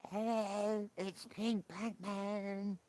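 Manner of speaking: high, slow silly superhero voice. Acted. Streamer trying a second Pink Batman voice, high and slow. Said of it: "Or does he talk like this?".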